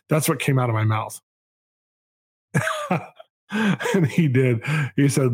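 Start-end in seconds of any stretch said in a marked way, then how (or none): 2.5-3.0 a quick amused laugh; short laugh. Real, not acted. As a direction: laugh briefly at yourself. Laughs at own retort to God.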